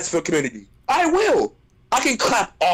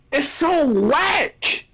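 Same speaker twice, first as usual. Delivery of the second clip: usual speaking voice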